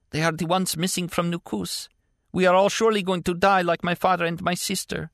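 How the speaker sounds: put-on a scared girl telling Martin of their fate; frightened girl. A narrator's reading. Girl speaks in cage.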